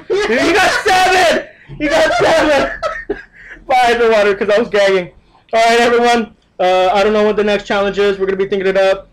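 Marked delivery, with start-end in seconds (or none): 0.1-3.2 laughing in amazement; high, delighted and laughing; excited laughing. Real, not acted. Amazed at dad's count.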